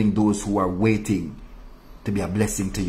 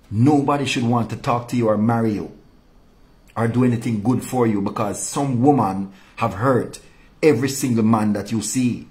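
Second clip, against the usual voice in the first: ordinary talk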